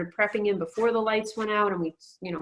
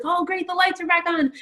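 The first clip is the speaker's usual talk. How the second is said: high-pitched retold excitement; excited husband; 10 semitones higher, 3 dB louder than usual. Husband thrilled that the power is back, excited. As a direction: imitate an elated husband, pitched well up. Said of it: "he was all excited".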